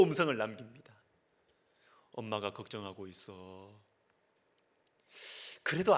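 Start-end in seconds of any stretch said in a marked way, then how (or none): 2.1-3.8 low, soft retold voicemail; gently worried father. Father's next voicemail to his runaway son, gently worried. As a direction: quietly imitate a father reaching out.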